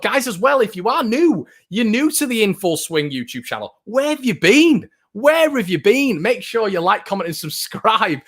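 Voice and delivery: high, bright-eyed hype at new viewers; where have you been, half teasing, way up high; excited. Real, not acted. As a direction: pump up the newcomers, big and high. Welcoming new viewers, hyped up.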